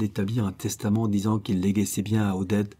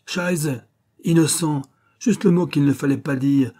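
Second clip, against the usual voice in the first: louder, higher mocking repeat of innocent; scornful outburst; 6 semitones higher, 6 dB louder than usual. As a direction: spit the word innocent back at her. Schiller seizes on the word.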